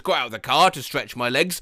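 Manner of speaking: ordinary talk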